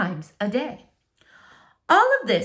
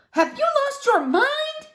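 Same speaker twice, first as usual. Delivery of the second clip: much higher, slow appalled squeal; appalled neighbour; slower than usual. Acted. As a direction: gasp it out in horror. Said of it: "said Missus Tickham".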